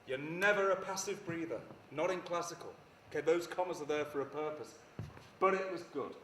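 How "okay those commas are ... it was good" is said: ordinary talk, nothing marked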